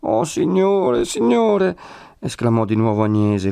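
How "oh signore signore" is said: high, slow wail; almost crying. A narrator's reading. Woman exclaiming at losing her protector, almost crying. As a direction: draw it out high, close to tears. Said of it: "quasi piangendo".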